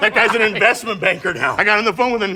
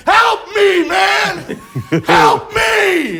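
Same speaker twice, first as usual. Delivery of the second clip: high, loud pleading shout for help; desperate yell; 10 semitones higher, 5 dB louder, much slower than usual. Retold or imitated. On the phone with the Uber driver, a loud desperate yell. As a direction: yell for help like you're stranded.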